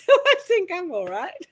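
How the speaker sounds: high, loud laughing delivery. Retold or imitated. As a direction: laugh through the punchline. Delivers spirit's joke, bursts out laughing.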